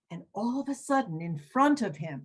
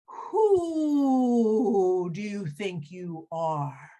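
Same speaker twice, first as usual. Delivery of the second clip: very slow hooting owl; 3 dB louder, much slower than usual. Acted. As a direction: stretch every word like a hoot. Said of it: "you know how owls talk".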